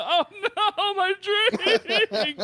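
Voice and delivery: high laughing cry over spilled drink; giggling oh no. Real, not acted. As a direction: laugh through the dismay.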